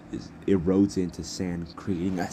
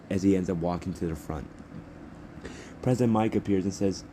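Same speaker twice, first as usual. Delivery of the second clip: ordinary delivery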